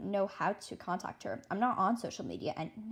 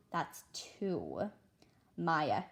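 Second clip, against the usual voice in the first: ordinary delivery, nothing marked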